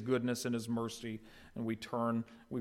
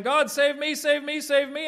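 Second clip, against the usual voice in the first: high, loud cries of save me; desperate pleading; 9 dB louder than usual. Pretend. People begging God for rescue, high desperate cries. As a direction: beg for rescue at the top of the voice.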